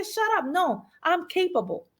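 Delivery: high, firm, pushing back at the voice; defiant self-talk. Retold or imitated. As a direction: shut the inner critic down. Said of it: "I say".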